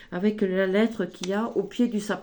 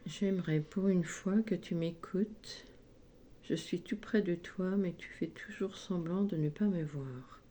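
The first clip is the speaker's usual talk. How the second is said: much softer, hushed reread of the card; 10 dB quieter than usual. Shown as a demonstration. Psychic rereads the card's message under her breath, hushed. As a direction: read it again, softly to yourself. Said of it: "Je vous lis ce qui est marqué sur la petite lettre".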